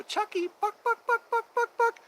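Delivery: high, loud clucking "buck buck"; chicken cluck. Retold or imitated. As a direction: cluck like a chicken to set the dog off. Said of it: "I used to do a chicken cluck".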